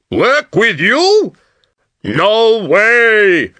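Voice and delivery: loud, slow, scornful walrus shout. Acted. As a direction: walrus shouting his refusal. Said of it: "shouted the walrus".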